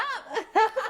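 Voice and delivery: high teary laugh-sob; laughing through tears. Real, not acted. Guest overwhelmed by her own words, laughing through tears. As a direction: laugh while crying.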